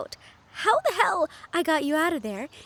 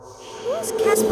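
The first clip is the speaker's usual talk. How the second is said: alarmed question; 9 semitones higher, much slower than usual. Acted. Guest confused as things go wrong.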